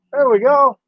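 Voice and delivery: happy little victory call; pleased exclamation. Real, not acted. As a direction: cheer it once the shot looks right. Pleased, repeated with a lift.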